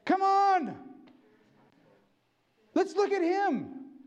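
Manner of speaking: rallying call, high and loud; eager rallying cry; excited urging. Retold or imitated. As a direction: fire them up to look to him. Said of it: "I'm saying".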